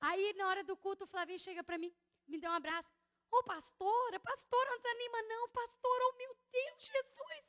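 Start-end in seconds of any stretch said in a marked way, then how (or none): 3.3-7.4 sing-song pleading, much higher than her own; comforting friend's voice. Retold or imitated. Friend hugging the pastor, begging her not to lose heart, higher mock plea.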